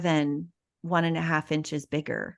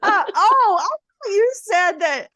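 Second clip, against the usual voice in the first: surprised; 12 semitones higher, 6 dB louder, slower than usual. Real, not acted. Surprised at a misheard word.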